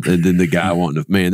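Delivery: normal talking voice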